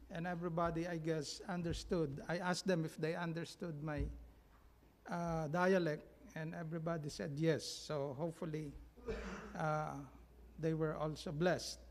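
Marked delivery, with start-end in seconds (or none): none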